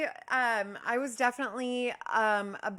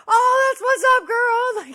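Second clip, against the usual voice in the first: very high, loud shout; screamed approval; 16 dB louder, slower than usual. Retold or imitated. Neighbor cheering back across the courtyard, screaming. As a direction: whoop it back with excitement. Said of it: "screaming across the courtyard at each other".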